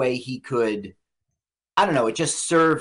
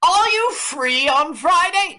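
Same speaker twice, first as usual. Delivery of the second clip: high, loud, drawn-out caricature; director on the phone; 14 semitones higher, 5 dB louder, slower than usual. Retold or imitated. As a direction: chirp a hammed-up phone greeting far above your own voice. Acting out director phoning Keitel.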